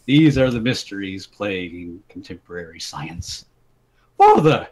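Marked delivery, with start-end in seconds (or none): 4.2-4.7 loud call across a valley; shouting over the hills; shouted. A narrator's reading. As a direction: call out to your father far away. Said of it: "his son shouted over the hilly valley".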